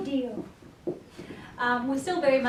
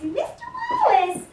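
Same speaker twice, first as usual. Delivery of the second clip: much higher playful calling of the name; high sing-song call. Real, not acted. Trainer fussing over her dog, high slow sing-song.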